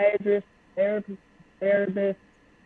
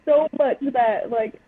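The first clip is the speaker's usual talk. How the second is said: usual speaking voice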